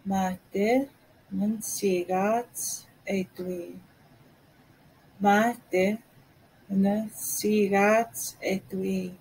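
nun sigat etui said slowly with a pause after Mate; drawn out so a learner can follow; slow, careful. Shown as a demonstration. Teacher modelling how to ask someone to slow down. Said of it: "a little bit slower".

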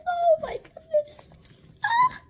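high, laughing squeal of delight; excited squeal. Real, not acted. Unboxing a toy, squealing with delight. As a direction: squeal with nostalgic glee.

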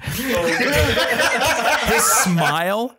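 high, very slow, broken by laughter; laughing narration. Real, not acted. GM describing the little boy's reaction, laughing too hard. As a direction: push the narration out through laughter.

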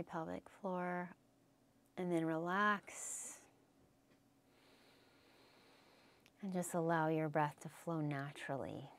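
soft, unhurried voice with roomy pauses; guided meditation. Real, not acted. Yoga teacher letting students observe their breath, guided meditation. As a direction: ease them back to quiet observing.

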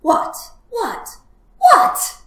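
very loud, repeated outburst; storybook fox losing patience; exasperated shouting. Acted. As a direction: fire off each word impatiently. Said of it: "shouted the fox".

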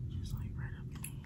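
real hushed, voiceless whisper; absent-minded whisper, barely audible. Real, not acted. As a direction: whisper it softly, as if to yourself. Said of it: "why am I whispering".

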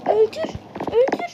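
high, rising urgent calls; urging kill. Real, not acted. Gamer urging a kill mid-fight, high urgent calls. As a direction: push hard for the kill.

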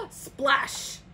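whispered splash. Acted. Sound effect word.

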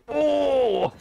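very high zombie wail; zombie moan. Shown as a demonstration. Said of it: "You know the".

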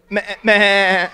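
loud bleating like a goat; goat noise. Shown as a demonstration. Pastor asks everyone for a goat noise, loud bleating. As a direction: imitate a goat's bleat. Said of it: "Everyone make a goat noise".

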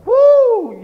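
high, loud "whoo" yelp; sudden sharp wince out loud; tooth-pain yelp. Acted. Preacher acting out biting on a sensitive tooth, loud high yelp. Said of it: "hit something on your tooth".

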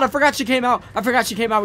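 panicked. Real, not acted. Fleeing a chaser.

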